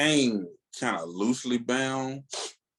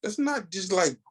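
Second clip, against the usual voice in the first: pitched-up plea to the other fans; high pleading; 6 semitones higher than usual. Retold or imitated. Fan urging other readers, high pleading. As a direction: beg them not to skip it, high and earnest. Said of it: "I would be like".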